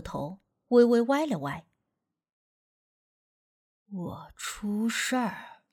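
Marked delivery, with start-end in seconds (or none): 3.9-5.6 put-on a ghost speaking through a boy, very slow; spirit-possessed voice. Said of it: "鬼仙上身时说话的口气".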